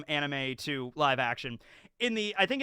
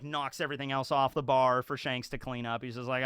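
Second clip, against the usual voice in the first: usual speaking voice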